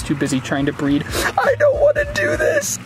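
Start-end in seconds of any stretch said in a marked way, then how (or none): 1.4-2.8 very high frightened wail; high scared whine. Real, not acted. Scared of adult terrapin.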